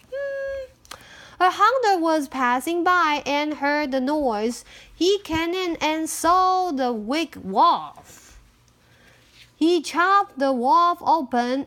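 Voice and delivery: plain reading voice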